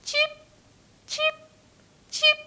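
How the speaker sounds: short, tiny cheeps; quick little chirps; chick cheep. Shown as a demonstration. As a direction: peep like baby chicks. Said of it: "The chicks".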